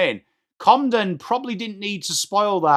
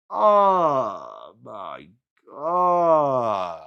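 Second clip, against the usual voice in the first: exasperated groan; 6 semitones lower, much slower than usual. Real, not acted. Groans at defensive lapse.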